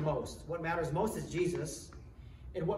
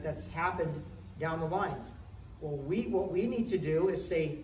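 ordinary delivery

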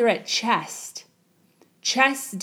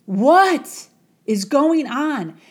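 slow, louder, raised alarmed cry; panicked inner thought; 5 semitones higher, 5 dB louder, slower than usual. Acted. Young man held under water, panicking in his head. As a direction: drag out the bewildered question in panic. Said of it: "thoughts of frustration and wonder".

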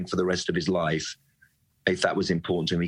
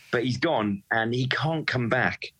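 ordinary delivery

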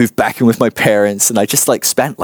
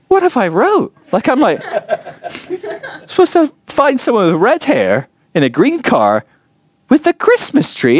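much higher, incredulous and despairing; mock disbelief. Reading his own silly list, high incredulous whine. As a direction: squeak out stunned disbelief.

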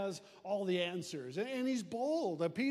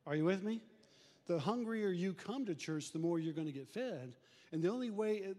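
usual speaking voice

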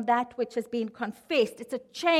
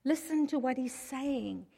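hushed, much softer shushing; mock whisper; 7 dB quieter than usual. Bystander hushing someone who asks what's going on, half-whispered. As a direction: shush them softly, as if in a crowd. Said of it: "And they'd whisper".

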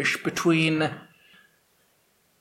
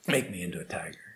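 whispered plea, much softer and lower; pleading whisper; 8 semitones lower, 10 dB quieter, much faster than usual. Acted. Jackal begging the holy man, whispered.

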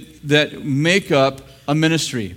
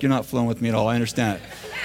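soft, understanding pastor voice, much softer; imitating pastoral people; 6 semitones lower, 5 dB quieter, faster than usual. Mocking pastoral people with a soft soothing voice. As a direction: gently play the patient pastor. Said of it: "you pastoral people are totally like".

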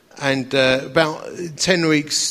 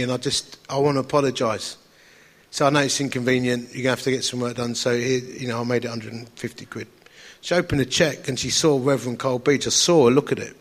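usual speaking voice

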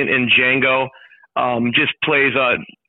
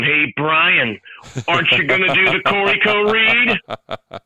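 slow, slightly higher mock plea; lightly voiced pestering coworker; imitating Andy; 4 semitones higher, slower than usual. Guest imitating Andy chasing the host down. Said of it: "he was chasing us down".

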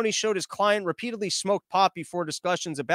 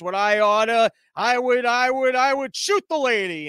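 drawn-out cartoon threat, climbing higher; old cartoon bluster; 5 semitones higher, 3 dB louder than usual. Drunk man boasting to his pals, cartoon bluster. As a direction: puff up an empty threat like a cartoon hothead. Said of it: "like in those old cartoons".

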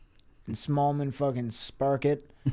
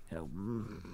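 grumbling under breath; 6 semitones lower, 14 dB quieter than usual. Retold or imitated. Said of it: "he grumble real hard under his breath".

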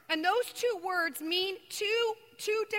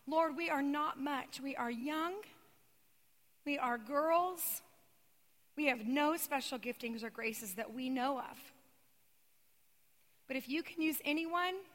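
soft, low, humble bedside prayer; hushed prayer; 3 semitones lower, 6 dB quieter than usual. Retold or imitated. Two young sisters kneeling at a bed, praying softly and humbly. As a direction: pray it quietly, kneeling beside the bed. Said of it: "we say this prayer out loud".

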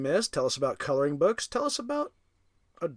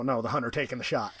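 normal talking voice

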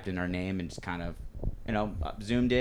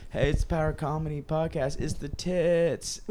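higher-pitched politician impression, stretching the last words; Bill Clinton; 7 semitones higher, slower than usual. Friend's promotion for the podcast, as a high, drawling presidential impression. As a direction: imitate a president's drawl, drawn out at the end. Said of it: "he starts doing, like, a Bill Clinton".

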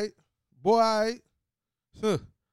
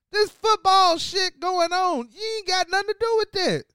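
much higher and louder, re-enacting a rant; yelling at the TV; 9 semitones higher, 5 dB louder than usual. Fan re-enacts yelling at the security guard on TV, hoarse-free mock shout. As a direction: shout it like he did at the TV. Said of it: "yelling at the T V".

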